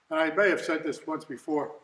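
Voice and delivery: ordinary talk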